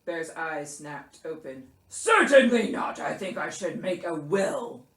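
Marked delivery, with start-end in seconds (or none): 1.9-4.8 loud burst, then grave bear; indignant Bear. A narrator's reading. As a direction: refuse loudly, then turn self-pitying. Bear snaps at Mouse.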